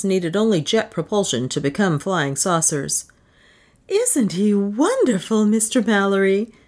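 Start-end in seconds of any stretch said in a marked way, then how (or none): 3.9-6.5 gasped admiration. A narrator's reading. Admirer gushes to the official about inventor, gasped. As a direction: marvel at him, drawing the words out. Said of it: "she gasped".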